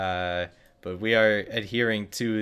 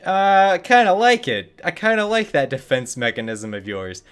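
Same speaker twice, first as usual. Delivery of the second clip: higher, louder mock glee; mock delighted human; 7 semitones higher, 7 dB louder than usual. Humans voiced relishing the pepper's defense, mock delighted. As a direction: play humans pleased by the burn. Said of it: "we're just like".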